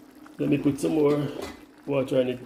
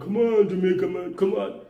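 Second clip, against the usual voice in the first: mocking Jamaican in America; 4 semitones higher than usual. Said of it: "the first thing he hears is".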